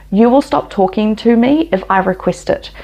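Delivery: usual speaking voice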